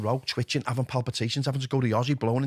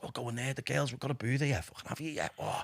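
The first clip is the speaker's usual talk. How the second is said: tempting addiction voice; 8 dB quieter than usual. Retold or imitated.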